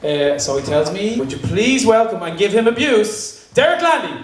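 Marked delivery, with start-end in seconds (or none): 1.2-4.2 stage announcer. Real, not acted. Host welcoming an author to the stage, rising announcer call. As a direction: build higher into the guest's name.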